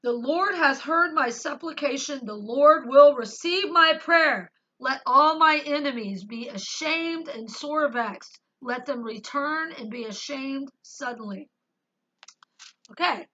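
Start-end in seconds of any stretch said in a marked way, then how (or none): 0.0-11.5 loud, slow, pleading scripture recitation; fervent psalm reading. Acted. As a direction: cry the verses out to God. Reads psalm aloud.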